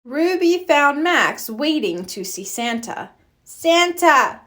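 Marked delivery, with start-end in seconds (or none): 3.4-4.4 little Max. A narrator's reading. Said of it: "said Max".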